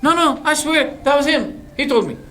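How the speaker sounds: pleading defendant. Acted. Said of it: "Then he says".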